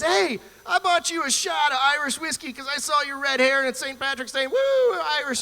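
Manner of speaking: high, hollering holiday reveler; rowdy stranger. Acted. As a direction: imitate a whooping, over-friendly bar stranger. Stranger hails him drunk on holiday.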